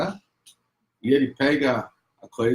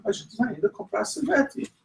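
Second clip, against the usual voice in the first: higher, breezy mom voice, covering a fib; sweet-talking mother; 9 semitones higher than usual. Retold or imitated. Mother fibbing to her son about ice cream, breezy. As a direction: keep it light and innocent.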